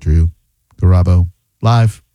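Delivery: True, long pause, Garabo, pause, Live; low delivery with long gaps between True, Garabo and Live; broken-up station greeting. Shown as a demonstration. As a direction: separate True Garabo Live into isolated pieces. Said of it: "Every time you say it one way, I'm gonna say it another".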